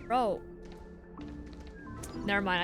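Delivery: ordinary talk, nothing marked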